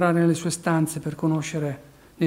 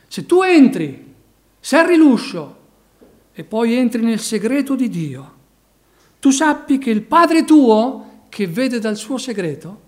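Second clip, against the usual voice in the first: loud, slow, impassioned sermon delivery; preaching at full force; 5 semitones higher, 9 dB louder than usual. Acted. Preacher urging his congregation, in a loud, slow, fervent preaching voice. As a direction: drive each phrase home like a revival preacher.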